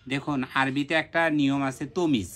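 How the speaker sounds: ordinary talk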